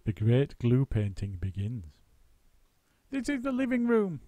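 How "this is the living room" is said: high, bouncy monkey voice; high excited monkey host; Mugglewump announcing. A narrator's reading. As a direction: announce it like an eager showman monkey. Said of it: "announced Mugglewump".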